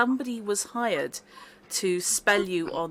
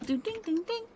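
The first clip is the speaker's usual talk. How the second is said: sung ding-dong chime, higher; doorbell chime; 12 semitones higher, 5 dB quieter, faster than usual. Pretend. Door chime imitated by GM.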